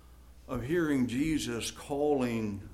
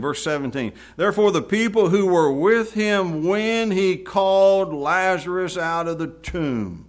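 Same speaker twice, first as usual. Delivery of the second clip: loud, projected Bible reading; scripture reading; 7 dB louder than usual. Acted. Preacher reads John's account of Lazarus, scripture reading. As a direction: proclaim the verses to the room.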